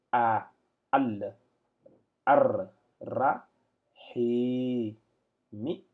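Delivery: each Arabic syllable "ar", "ra", "ma", "ni" said apart, loud and separate; detached reading. Shown as a demonstration. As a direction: read it in separate syllables, not joined. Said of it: "si je devais lire en attaché".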